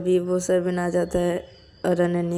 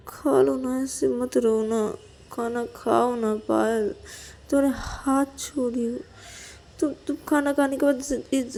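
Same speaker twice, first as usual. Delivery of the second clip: begging; 5 semitones higher than usual. Acted. Acted begging with folded hands.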